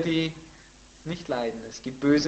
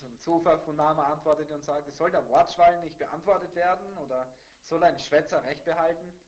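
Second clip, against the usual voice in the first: raised, louder Bible recitation; forceful scripture reading; 4 semitones higher, 9 dB louder than usual. Acted. Pastor reading Zophar's attack on Job to listeners, forceful recitation. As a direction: read it out as a pointed accusation.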